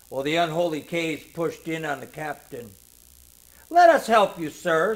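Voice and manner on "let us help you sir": loud, pressing urgent plea. A narrator's reading. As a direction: push to help urgently. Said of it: "said Krakow urgently".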